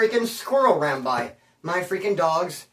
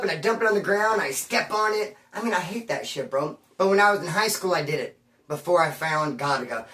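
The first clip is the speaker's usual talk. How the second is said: ordinary talk, nothing marked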